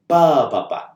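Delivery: ba, ba, ba with the first ba stressed and the next two light; dactyl rhythm. Shown as a demonstration. Teacher showing students the dactyl, first ba stressed. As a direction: tap out one long and two short beats. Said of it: "the dactyl as a foot sounds like".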